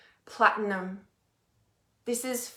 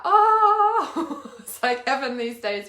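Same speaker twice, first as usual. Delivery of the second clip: laughing; 3 semitones higher, 5 dB louder, slower than usual. Real, not acted. Reading a viewer's rainbow comment.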